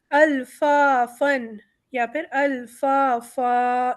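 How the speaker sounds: الفافن with nun, then الفافا with a long aa; tanween versus madd. Shown as a demonstration. Teacher contrasts the continued and paused endings. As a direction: contrast the tanween and the stretched stop. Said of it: "حروف مد بڑھائیں گے".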